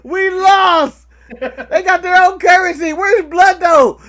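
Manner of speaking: loud, high laughing shout; laughing outcry. Real, not acted. Co-host mock-mourning the renamed arena, laughing shout. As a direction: holler it through the laughter.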